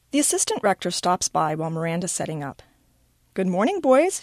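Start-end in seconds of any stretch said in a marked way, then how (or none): 3.3-4.2 higher, lightly called greeting; calling out. A narrator's reading. Assistant rector greeting two boys at the classroom, calling out. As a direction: call it out in a lifted voice. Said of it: "she calls out to Everett and Max".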